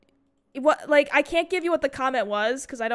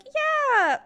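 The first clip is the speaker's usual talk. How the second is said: high, strained fake-fine yes; high forced brightness; forced cheer; 13 semitones higher than usual. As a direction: chirp it like nothing's wrong. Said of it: "I'm like".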